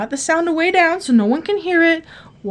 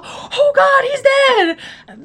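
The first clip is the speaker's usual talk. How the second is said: much higher, crying wail of horror; retold crying wail; 12 semitones higher, much slower than usual. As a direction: wail it like the sobbing girlfriend. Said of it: "she was all".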